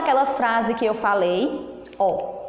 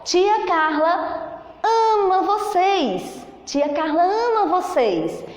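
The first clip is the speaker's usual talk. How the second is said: higher and slow, full of delight; enthusiastic exclamation; 6 semitones higher, slower than usual. Shown as a demonstration. Teacher showing kids an exclamation, enthusiastic and bright. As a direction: announce it like happy news. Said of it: "Eu estou entusiasmada, eu estou alegre".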